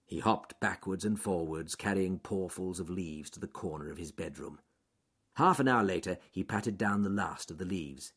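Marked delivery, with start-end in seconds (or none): none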